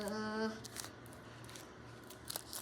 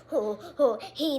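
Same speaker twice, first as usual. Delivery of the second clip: ordinary talk, nothing marked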